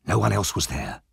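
plain reading voice

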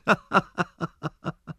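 put-on high, gleeful cackle of a boy; boyish laughter. A narrator's reading. Charlie Bates rolling on the ground, laughing high. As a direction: laugh your head off like a street urchin. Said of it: "laughed and laughed".

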